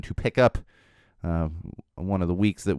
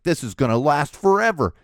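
despairing parent; 5 semitones higher, 3 dB louder than usual. Retold or imitated. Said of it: "You're like".

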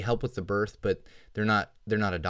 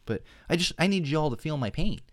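exasperated; 8 semitones higher than usual. Real, not acted. Exasperated rant about dead router.

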